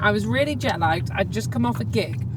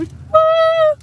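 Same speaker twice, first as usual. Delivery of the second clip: very high, loud whoop; a delighted shout of joy; excited whoop; 6 dB louder than usual. Real, not acted. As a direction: cheer with real glee. Excited on the way to the sax.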